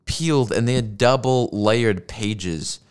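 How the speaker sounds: ordinary delivery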